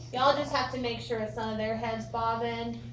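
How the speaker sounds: ordinary delivery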